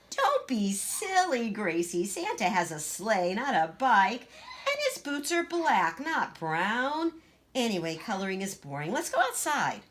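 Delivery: put-on high, bossy little girl voice; Greta, boastful child. Big sister boasting to Gracie, high and bossy. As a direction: show off being taller.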